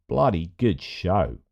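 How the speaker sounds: mock Australian accent, "Bloody good show" drawn out slowly with stress on "bloody". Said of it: "those accents I get in trouble for".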